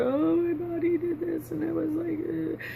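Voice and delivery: high, soft whimpering into the phone; imitated crying. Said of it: "I'm crying on the phone".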